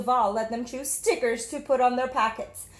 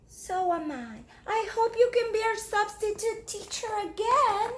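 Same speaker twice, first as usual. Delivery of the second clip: put-on young girl voice; 10 semitones higher than usual. Jamaica telling her teacher, in a little girl voice. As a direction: speak up brightly as a small child. Girl's hopeful reply.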